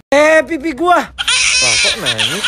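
put-on very high wailing baby voice; baby crying after cheek pinch.

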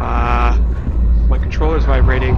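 loud, excited gaming shouts; excited gamer. Real, not acted. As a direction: hype yelling at the ghost. Ghost fight, loud yelling.

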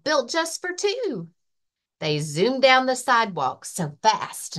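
lively, sing-song picture book reading; reading to children. Acted. Librarian reading a rhyming book to children, lively. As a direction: read it like story time for little kids. Library story time read-aloud.

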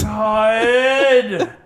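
loud exasperated yell; frustrated shout. Real, not acted. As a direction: bellow it in frustration. Frustrated outburst at game.

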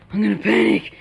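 loud panicked cry; sudden loud alarm; panicked outburst. Real, not acted. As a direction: burst out loudly in panic. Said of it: "I'm panicking, guys".

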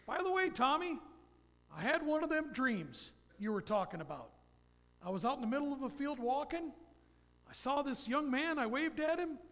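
a hint of a father choking up; lightly imitating a friend telling his dream; moved grieving father. Friend John's tearful dream retold.

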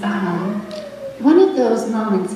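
usual speaking voice

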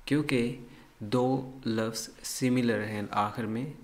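ordinary talk, nothing marked

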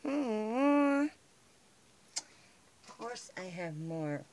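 normal talking voice

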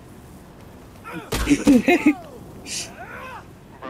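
high disbelieving laugh; incredulous laugh. Real, not acted.

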